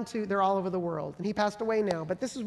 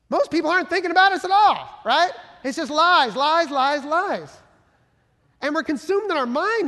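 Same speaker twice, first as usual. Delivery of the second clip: forceful preaching; 6 semitones higher, 7 dB louder than usual. Real, not acted. Preaching against fearful thoughts.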